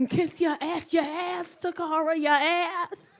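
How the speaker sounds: high, loud wounded repetition; mock scandalised boyfriend. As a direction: imitate his disbelief, piece by piece. Imitates partner's shocked repetition.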